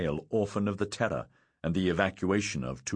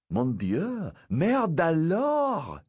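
high French exclamation of alarm; shocked gasp; 10 semitones higher, 3 dB louder, much slower than usual. A narrator's reading. Sophie aghast at the climbing boy, shocked gasp. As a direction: cry out aghast, drawing out the last words. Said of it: "Sophie gasped".

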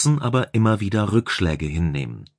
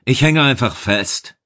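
louder, higher call; 4 semitones higher, 5 dB louder than usual. A narrator's reading. Chief engineer calls from inside the block that he is stuck. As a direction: raise your voice to be heard outside. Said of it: "rief Schablonski".

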